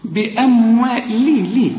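slow "بأموال" broken off into a thin "لي"; lighter lam. Shown as a demonstration. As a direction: repeat "لي" with a light, clear l. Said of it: "طلعها أكثر رقة".

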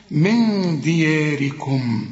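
"من دياركم" very slowly, held n and thin open vowel; correct light diya. Shown as a demonstration. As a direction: stretch "دياركم" with a light "yaa". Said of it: "شوف، اوعى تقول".

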